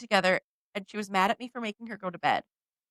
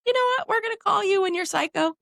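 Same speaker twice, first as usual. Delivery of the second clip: teasing husband; 13 semitones higher than usual. Retold or imitated.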